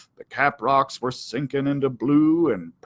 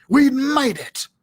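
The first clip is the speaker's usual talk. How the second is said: higher, joyful We'd made it; relieved triumph; 10 semitones higher than usual. A narrator's reading. Dog cheering after escaping the coyote brothers, relieved.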